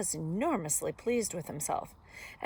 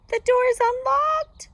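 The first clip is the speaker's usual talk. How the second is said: very high, loud, slow falsetto; fake Granny voice; 14 dB louder than usual. A narrator's reading. Wolf luring the girl in, fake Granny voice. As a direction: call out slowly in high falsetto.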